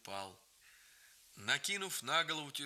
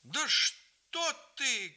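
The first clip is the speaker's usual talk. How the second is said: high, loud, drawn-out cry; alarmed cry; 11 semitones higher, 5 dB louder, much slower than usual. A narrator's reading. Grandmother startled from prayer, crying out. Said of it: "крикнула бабушка".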